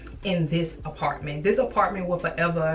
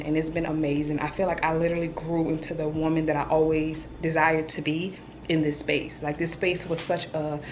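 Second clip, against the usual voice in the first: normal talking voice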